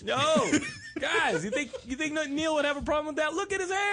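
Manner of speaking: high, laughing, then a shout. Real, not acted. Host laughing and shouting about the director's hair. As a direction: crack up and yell the punchline.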